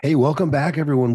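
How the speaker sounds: normal talking voice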